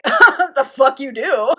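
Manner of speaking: slow, loud, laughing disbelief; laughing scoff. Retold or imitated. Father laughing off his son's wish to play violin. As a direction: scoff at him through laughter.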